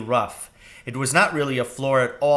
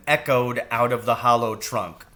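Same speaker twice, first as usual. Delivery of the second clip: plain reading voice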